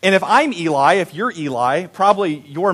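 ordinary talk

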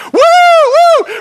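high, loud hooligan whoops; mock whoops of two young hooligans; whooping. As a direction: whoop like rowdy kids on the run. Hooligans whooping while running.

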